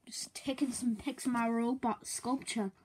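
slow whispered remark from above; casual whisper. Acted. Brother from ceiling.

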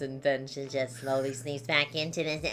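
the player showing the voice returning on its own; sprite voice creeping back. Acted. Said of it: "she talks like this".

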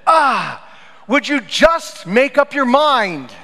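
annoyed, slow and high, half laughing; frustrated driver yelling at a squirrel, exasperated. Retold or imitated. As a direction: scold the squirrel, fed up. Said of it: "I did say words to that squirrel".

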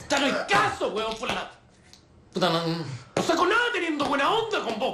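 high-pitched angry rant; exasperated outburst. Acted. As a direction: lose patience, voice climbing sharply. Interrogador pierde la paciencia con detenida. (estallido exasperado, voz aguda)

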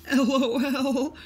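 high, loud silly squeal; guffawing cars. A narrator's reading. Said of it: "guffawed the cars".

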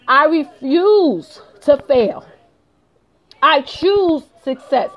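slow, every word weighed; slow and resolute, as if taking an oath; slow determined vow. Real, not acted. Vlogger vowing to her viewers never to fail, slow and resolute. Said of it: "motivational declaration".

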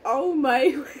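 laugh-cry through the words; laughing tearfully. Retold or imitated. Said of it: "I'm gonna be like".

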